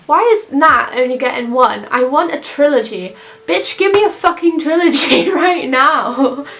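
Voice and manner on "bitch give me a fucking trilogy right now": hammed-up outraged fan demand, higher pitched; mock demand. Playful fan demand to the studio.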